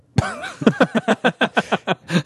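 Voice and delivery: high laugh. Real, not acted. Laugh at poppy seed.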